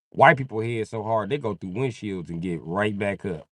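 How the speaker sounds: usual speaking voice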